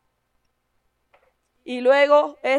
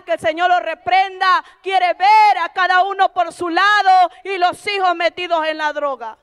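higher and louder, building to a shout; fiery preaching; 4 semitones higher, 4 dB louder than usual. Acted. Preacher warning the church about Satan, building to a shout. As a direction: preach it hard against Satan.

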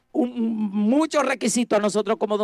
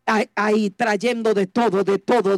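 usual speaking voice

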